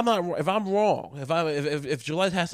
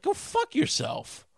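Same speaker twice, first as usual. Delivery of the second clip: high-pitched, drawn-out mock retort; oburzona riposta; slower than usual. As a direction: throw it back high and slow, in mock indignation. Acted indignant reply to ex-convict.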